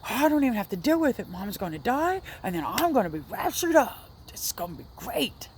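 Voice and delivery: high, quick burst of glee; excited, pitch jumping up at first; retold excitement. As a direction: gush as you recall your excitement. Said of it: "I just got excited".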